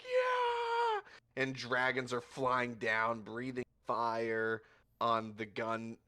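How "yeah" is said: very high, loud yell; crowd cheer. Acted. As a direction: roar back in triumph. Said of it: "everyone's just like".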